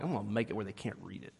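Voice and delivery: low quick scheming aside; sly mutter. Retold or imitated. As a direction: grumble it under your breath. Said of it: "going like".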